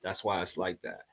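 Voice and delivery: ordinary talk, nothing marked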